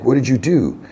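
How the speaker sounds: a touch of horrified disbelief, retold; mock alarm. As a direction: lightly act out an alarmed demand. Smee discovers the face hugger.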